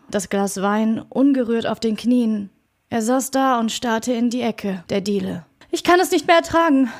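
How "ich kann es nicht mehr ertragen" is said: higher, fast, fearful; anxious repeat. A narrator's reading. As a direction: repeat it, less loud but still afraid. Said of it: "wiederholte er".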